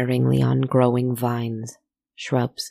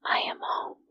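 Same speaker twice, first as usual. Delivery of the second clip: soft, unvoiced whisper to oneself; 8 dB quieter than usual. A narrator's reading. Knot Mother arriving home after a year, whispering. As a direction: whisper it under the breath. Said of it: "she whispered".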